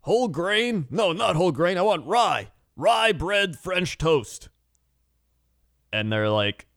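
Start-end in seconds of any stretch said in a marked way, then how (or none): 0.0-4.5 higher, slow and picky mock old diner; an old man ordering bread too precisely; hammed-up particular old customer; fussy old man. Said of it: "the guy's like".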